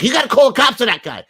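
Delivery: high, fast mock wife; mimicking wife. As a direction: rattle it off like the worried wife. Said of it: "my wife's like".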